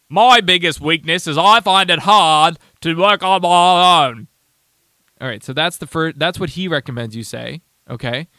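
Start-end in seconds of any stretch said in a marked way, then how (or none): 0.1-4.3 slow, loud, stiff recital of the weakness; mock stiff answer. Host mimics the TikTok man's answer, loud and slow. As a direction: overdo it like a nervous candidate reciting. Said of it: "one of where you give his answer".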